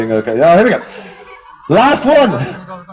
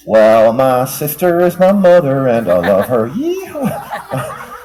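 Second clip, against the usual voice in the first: Alabama hillbilly; 8 semitones lower, slower than usual. Acted. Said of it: "Juggling in Alabama like this".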